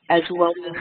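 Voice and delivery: usual speaking voice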